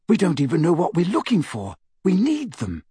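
usual speaking voice

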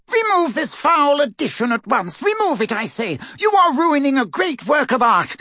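high, loud pompous outrage; outraged. A narrator's reading. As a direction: demand it imperiously. Outraged portrait demands.

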